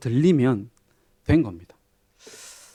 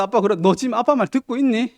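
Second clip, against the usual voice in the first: higher, mock-stern father on the phone; pitched-up imitation of a nagging father; scolding father; 9 semitones higher, 4 dB louder than usual. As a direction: play the exasperated dad checking up.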